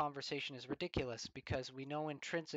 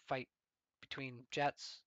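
ordinary delivery

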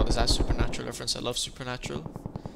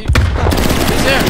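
excited shout; 10 semitones higher, 13 dB louder than usual. Real, not acted. Spotting enemy in hide-and-seek game.

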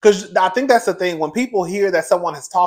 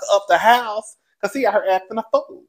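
ordinary delivery

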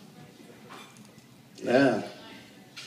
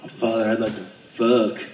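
mock seductive; 4 semitones lower than usual. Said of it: "I'm like".

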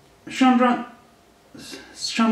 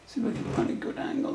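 whispering; 7 semitones higher, 8 dB quieter, faster than usual. Real, not acted. Fiddling with the camera.

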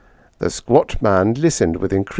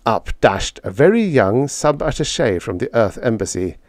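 ordinary delivery, nothing marked

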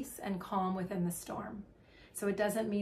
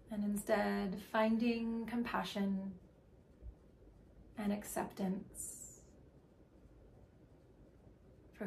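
guided meditation; 4 dB quieter than usual. Acted. Leading listeners into breath and body awareness, calm meditation voice. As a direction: guide them gently, letting phrases stretch and settle.

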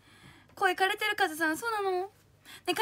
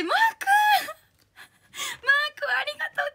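much higher, louder delighted cry; excited shout; 10 semitones higher, 6 dB louder, much slower than usual. Real, not acted. Spotting fan Mark in the chat, delighted shout. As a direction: call his name out in sheer delight.

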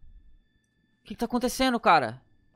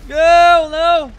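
loud, higher cry of refusal; panicked shout; 4 semitones higher, 11 dB louder, much slower than usual. Real, not acted. Player with danger coming at him, panicked shout. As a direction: yell it as danger closes in.

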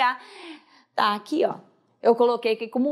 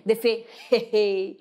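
drawn out; 2 semitones lower, slower than usual. Shown as a demonstration. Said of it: "Tem até uma risadinha".

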